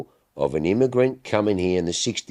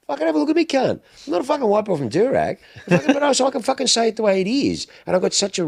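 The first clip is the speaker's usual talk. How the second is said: pitched way up, fired-up protest; heated rant; faster than usual. Real, not acted. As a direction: let it rip, worked up and defiant. Heated rant about his background.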